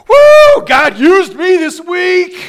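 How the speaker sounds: high, loud, laughing shout of joy; joyful shout. Pretend. A churchgoer bursts through the doors, joyful laughing shout. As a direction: shout it out like you can't contain it. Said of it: "exclaimed with a loud cry".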